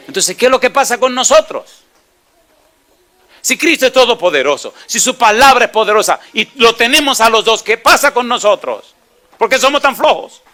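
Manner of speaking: fiery rebuke from the pulpit; preaching at full force. Real, not acted. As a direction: hammer the congregation with it. Preacher rebuking congregation, climax.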